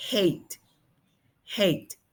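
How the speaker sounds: "Hate" ending on a crisp T, silent E; final T demo. Shown as a demonstration. Teacher isolates the last sound for students, "Hate" with clear T. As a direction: land "Hate" on its T. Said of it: "El sonido es de la T".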